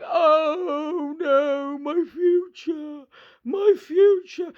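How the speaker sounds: whiny high wail, dragged out slowly; mock-sob it like a crybaby; mock crying. Mocking protesters wailing about their future. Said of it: "They're going round crying".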